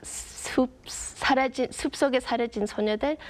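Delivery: 숲속에 사라진 소녀들 said in native Korean; the Korean title 숲속에 사라진 소녀들 read out in Korean. Shown as a demonstration. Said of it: "it got translated into".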